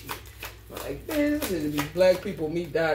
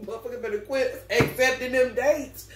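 ordinary talk

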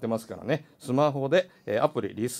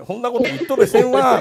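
higher, louder broad Nagoya dialect retort; mock Nagoya dialect; 10 semitones higher, 8 dB louder than usual. Host mocking the meow rumour, loud Nagoya dialect retort. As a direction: bark it back in thick Nagoya dialect. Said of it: "名古屋だとミャーミャー言う".

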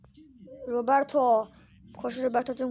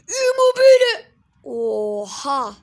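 excited shout; 2 semitones higher, 14 dB louder, much slower than usual. Real, not acted. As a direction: scream the player's name in hype. Hyped shout at goal chance.

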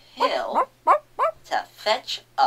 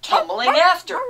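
loud sing-song; 13 semitones higher, 9 dB louder than usual. Acted. Loud rhyme finish.

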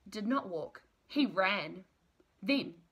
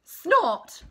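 much louder, higher machine snort; 9 semitones higher, 12 dB louder than usual. Acted. The digger answers the baby bird with a loud snort. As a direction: blast it out like a huge engine. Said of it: "the big thing just said".

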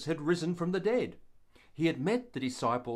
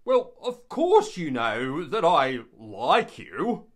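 louder, slower, evasive reply; hedging Peter; 8 dB louder, slower than usual. Acted. Peter answering Jesus a second time, evasive and louder. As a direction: dodge the word love. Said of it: "And Peter said".

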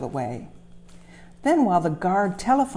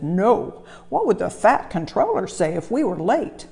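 driver voice; 3 semitones higher, 4 dB louder than usual. Acted. Driver scolds Thomas in story.

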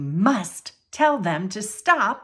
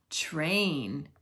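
ordinary delivery, nothing marked

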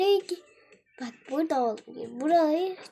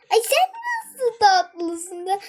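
cooing; 4 semitones higher, 6 dB louder, slower than usual. Real, not acted. Cooing at cute animal.

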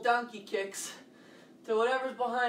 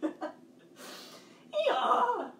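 laughing donkey bray; 13 semitones higher than usual. Shown as a demonstration. Laughing, brays once more.

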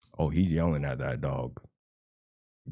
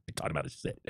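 breathy whispered mimic of his boast; whispered mockery; 3 semitones lower, 10 dB quieter, much faster than usual. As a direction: sneer his line under your breath.

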